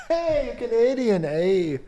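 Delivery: high, loud Canadian caricature greeting; mock shout. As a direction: call out in a hammed-up Canadian voice. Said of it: "because you shout".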